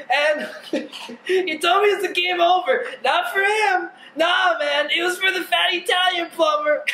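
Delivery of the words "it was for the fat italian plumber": shouted. Real, not acted. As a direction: yell it back at him. Repeated, second time shouted.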